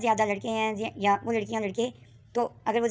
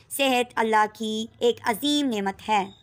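usual speaking voice

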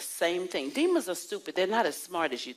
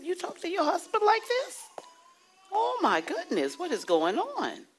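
shocked; 12 semitones higher than usual. Retold or imitated. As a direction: act scandalized at her manners. Retold shocked rebuke to woman.

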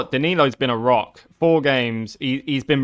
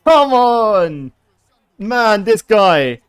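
high, loud triumphant roar; goal celebration; 9 semitones higher, 7 dB louder, much slower than usual. Real, not acted. Gamer celebrating a goal against a tough rival, loud. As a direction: roar it out after scoring.

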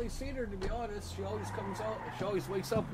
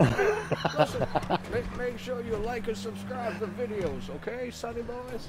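put-on slow, raised, preachy old-timer; old man voice; 4 semitones higher, 5 dB louder than usual. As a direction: lecture the kids like a grandpa. Old-timer lecturing viewers to subscribe.

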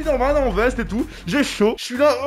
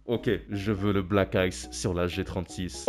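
much lower, slow and solemn; manly deep voice; 11 semitones lower, 8 dB quieter than usual. Pretend. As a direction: put on a macho low voice. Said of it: "cette voix virile que ça me fait". (voix virile grave et lente)

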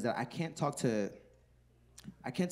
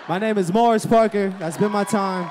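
shouted, louder name announcement; loud sign-off; 5 semitones higher, 11 dB louder than usual. Real, not acted. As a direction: call it out to the room. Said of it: "closing shout-out".

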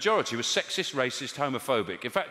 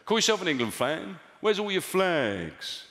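generic bloke; 6 semitones higher, slower than usual. Retold or imitated. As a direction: bellow it like a flag-waving bloke. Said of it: "he went, uh".

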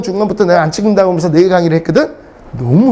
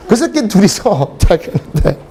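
ordinary talk

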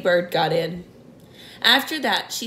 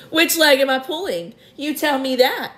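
higher, teasing glee; gleeful; 6 semitones higher than usual. A narrator's reading. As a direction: tease the earthworm. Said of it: "wiggling with glee".

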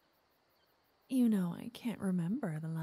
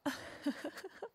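airy whispered giggle, high; a light teasing laugh under the breath; breathy giggle; 8 semitones higher, 5 dB quieter than usual. Acted. Teasing the listener's boring hobbies.

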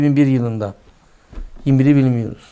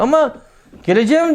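mock eager promise; 10 semitones higher than usual. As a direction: play the man who swears he'll pray. Hypocrite promising again.

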